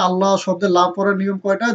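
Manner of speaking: ordinary delivery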